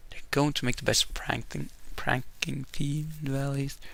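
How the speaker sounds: muttered second read. Real, not acted. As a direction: mumble the choice over again. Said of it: "reading choice again".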